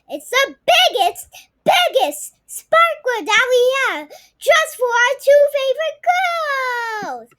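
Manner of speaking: high, loud, bright mom voice; mom's voice. Acted. Mom teasing the girls about the biggest sparkler, high and bright. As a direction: promise it like a treat.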